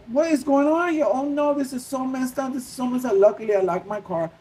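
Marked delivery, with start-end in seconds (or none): 0.1-3.1 high-pitched fretting, retold; freaking out. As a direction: act out your own panicked reaction. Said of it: "because I was freaking out".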